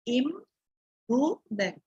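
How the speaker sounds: "improvement" drawn out slowly, syllable by syllable. Shown as a demonstration. Teacher modelling "improvement" for students, slowly by syllables. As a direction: slowly stretch out "improvement" with care. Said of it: "So proper stress should be given".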